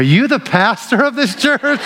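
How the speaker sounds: asked through a laugh; giggling out the question in disbelief. Retold or imitated. Old classmate at church, laughing in disbelief.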